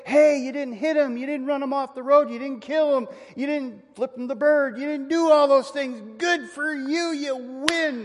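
high and loud, a cheery pat on the back; mock congratulation. Preacher mocks what James doesn't tell grumblers, with cheery false praise. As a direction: cheer someone for not doing worse. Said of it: "He doesn't say".

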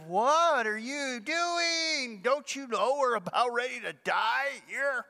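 a frantic captain shaking a sleeper awake; alarmed ship captain. Retold or imitated.

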